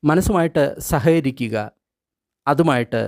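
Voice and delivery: ordinary talk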